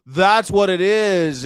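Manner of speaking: slow, loud and high eureka; excited realisation. Real, not acted. Comic cracking the New York mystery, triumphant. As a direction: announce a great discovery, stretching each word. Sudden realisation of his theory.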